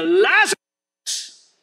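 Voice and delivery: high, drawn-out call into the tomb; Jesus shouting at the tomb for Lazarus to come out, high and drawn out; commanding shout. Retold or imitated. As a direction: call the dead man out, stretching his name. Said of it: "Tapos siya ay sumigaw".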